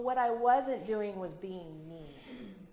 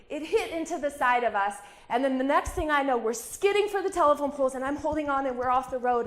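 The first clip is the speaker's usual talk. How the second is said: louder and higher, racing through the crash; raised voice reliving the skid; loud, urgent retelling; 6 semitones higher, 10 dB louder than usual. Retold or imitated. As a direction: call it out as if it's happening again. Reliving the skid toward poles.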